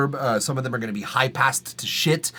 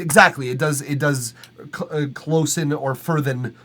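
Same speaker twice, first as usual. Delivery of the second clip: ordinary delivery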